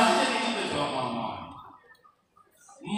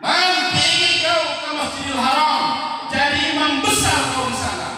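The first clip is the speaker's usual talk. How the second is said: angry mother's shout; 4 semitones higher, 6 dB louder than usual. Retold or imitated. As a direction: yell it at the naughty son in anger. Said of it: "Marah, ya marah". (ibu berteriak marah kepada anaknya)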